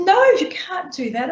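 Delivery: high urgent no, you can't; alarmed pleading to a friend in tears; urgent plea. Retold or imitated.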